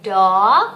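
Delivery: "Dog" said like Thai "dawk", loud and low; like Thai ดอก. Shown as a demonstration. Mommy models for child, "Dog" like ดอก. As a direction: draw "Dog" out with an "aw" vowel, like ดอก. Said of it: "ออกเสียงเหมือนดอกเลยอะค่ะ".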